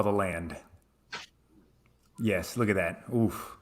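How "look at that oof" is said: normal talking voice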